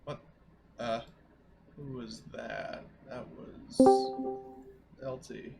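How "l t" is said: ordinary talk, nothing marked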